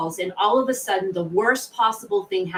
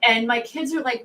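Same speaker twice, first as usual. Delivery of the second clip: ordinary talk, nothing marked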